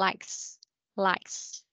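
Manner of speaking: "likes" with a clear hissed final s. Shown as a demonstration. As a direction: push the s out at the end of "likes". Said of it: "mình phải xì ra". (đọc "likes" xì rõ âm S cuối)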